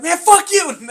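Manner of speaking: high, loud defiant yell. Retold or imitated. Little brother yelling back at the crackhead. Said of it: "My brother's like".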